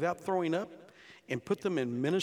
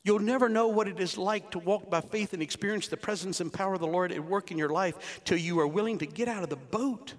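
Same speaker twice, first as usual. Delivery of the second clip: normal talking voice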